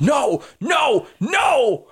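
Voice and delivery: high, wailing shout; crying out. Acted. Voice heard in the player's head, high anguished wail. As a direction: cry out in high-pitched protest. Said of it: "you hear in your head".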